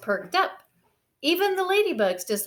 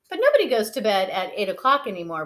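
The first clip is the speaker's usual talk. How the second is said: protesting; 4 dB louder, faster than usual. Acted. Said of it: "protested Brother".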